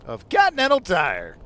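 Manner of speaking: ad read. Acted. Sponsor read.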